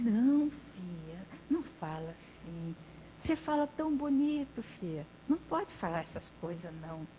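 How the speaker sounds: kindly grandpa gently pleading; gentle old man. Acted. Old man gently chides her.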